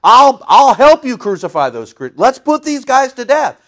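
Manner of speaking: hammed-up persecutor; mock young Paul. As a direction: cheer it like a zealot egging on a mob. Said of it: "Paul was".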